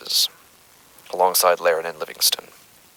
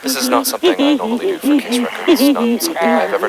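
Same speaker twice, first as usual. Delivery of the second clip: distorted interference; 5 dB louder than usual. Acted. Investigator's recording hijacked by interference, warped glitching voice. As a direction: voice warped by eerie interference, much higher and louder.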